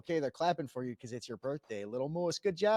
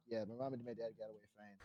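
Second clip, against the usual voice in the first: much softer, quick throwaway mumble; muttered aside; 5 semitones lower, 12 dB quieter, faster than usual. Retold or imitated. Reassuring himself his parents survived, a quick soft mutter. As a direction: toss it off under your breath, fast.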